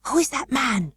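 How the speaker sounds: high, hushed and startled; loud alarmed whisper. A narrator's reading. Colin spotting a stranger over the wall, alarmed whisper. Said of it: "in a loud, suddenly alarmed whisper".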